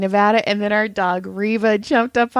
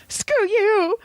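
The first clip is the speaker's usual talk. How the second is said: mock huffy; 12 semitones higher than usual. Retold sleepy dismissal.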